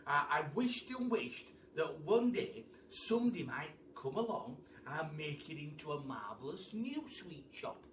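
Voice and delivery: ordinary delivery, nothing marked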